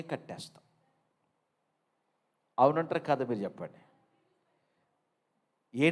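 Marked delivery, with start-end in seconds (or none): none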